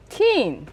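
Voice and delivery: put-on "Teen" with a tight short i in place of e; New Zealand "ten". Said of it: "because he said tin".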